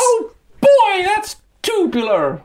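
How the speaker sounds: put-on high, slow gee-whiz kid voice; squeaky old-timey skater kid; wholesome 1950s kid. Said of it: "skaters did you ever hang out with that went".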